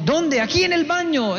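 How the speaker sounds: high-pitched calling across the house; mock yelling. Dad and son calling across house, yelling. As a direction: imitate shouting from another room without real volume. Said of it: "está gritando a todo pulmón".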